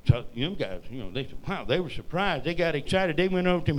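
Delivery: ordinary talk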